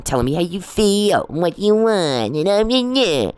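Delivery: high, drawn-out mocking whine. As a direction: mimic his whining with scorn. Mocking his whiny act.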